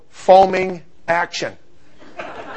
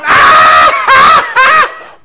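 loud, high mock shriek of laughter; exaggerated helpless cackling; imitated laughing; 9 dB louder, much slower than usual. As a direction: imitate girls howling with laughter. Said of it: "are falling backwards laughing".

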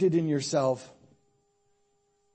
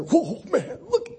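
excited whoa; 12 semitones higher, 7 dB louder, slower than usual. Retold or imitated. Seeing her for the first time.

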